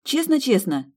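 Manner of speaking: Честно-честно high and eager; earnest echo. Acted. Woman earnestly repeating the promise, high and eager. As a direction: answer with childish sincerity.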